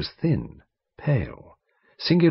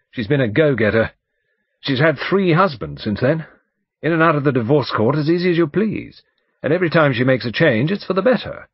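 loud, higher-pitched gossipy barrister; blustering lawyer, gossiping; 6 semitones higher, 5 dB louder than usual. Acted. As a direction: gossip loudly with relish.

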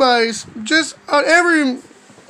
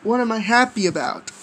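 plain reading voice

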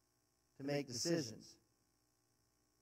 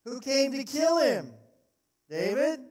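loud, higher call back across; shouted reply; 7 semitones higher, 6 dB louder than usual. Acted. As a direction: demand an answer across the gap.

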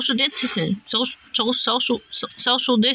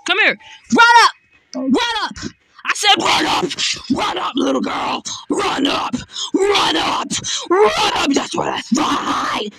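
taunting challenge; 5 semitones higher than usual. Real, not acted. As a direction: bark the dare at her. Taunting the enemy to fight.

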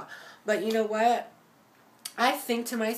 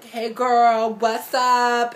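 much louder chirpy fake greeting; mock friendly text greeting; 3 semitones higher, 10 dB louder, much slower than usual. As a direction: play the sweet two-faced friend. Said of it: "text out my iPhone and be like".

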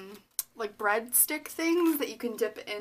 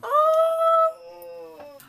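very high, loud "oh"; high-pitched gasp of delight; delighted squeal; 6 dB louder than usual. Real, not acted. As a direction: squeal at a lovely surprise. Delighted reaction to a gift.

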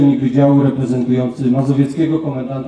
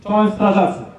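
called-out greeting; 6 semitones higher than usual. Real, not acted. Greeting the firefighters on parade.

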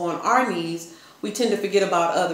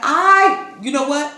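excited; 8 semitones higher, 6 dB louder, slower than usual. Real, not acted. Laughing at hitting numbers.

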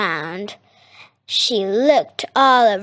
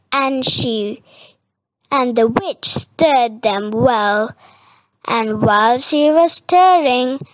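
ordinary delivery, nothing marked

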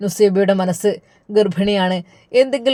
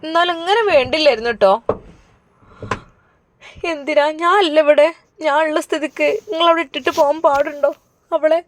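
a higher-voiced worried mother scolding her husband; the mother chides her husband, pitched up; lightly voiced mother reproaching; upset mother; 7 semitones higher than usual. Mother keeps reproaching father.

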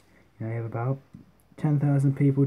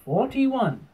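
much higher darts caller's call; referee voice; 10 semitones higher than usual. Shown as a demonstration. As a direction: sing out the score like a referee. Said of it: "get our referee voice on".

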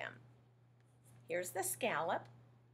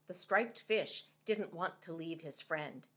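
ordinary delivery, nothing marked

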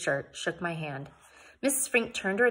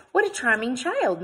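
higher, louder ladylike exclaiming; delighted exclamation; 5 semitones higher, 6 dB louder than usual. A narrator's reading. Missus Frink meeting little Sudie, exclaiming. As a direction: exclaim over a child, pitched up. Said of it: "Missus Frink exclaimed".

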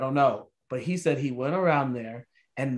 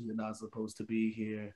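quiet repeated warning; 6 semitones lower, 12 dB quieter than usual. Retold or imitated. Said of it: "He was just quiet".

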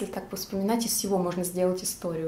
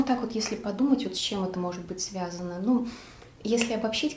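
ordinary talk, nothing marked

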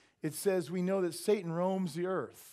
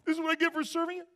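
much higher and louder, fast whiny protest; mock whining complaint; 14 semitones higher, 9 dB louder, faster than usual. Preacher mimicking believers complaining to God, high whine. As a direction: whine at God like a spoiled child.

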